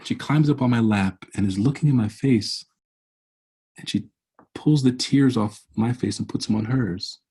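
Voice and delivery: ordinary talk, nothing marked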